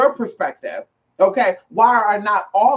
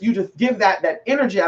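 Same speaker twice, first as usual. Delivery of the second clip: ordinary talk, nothing marked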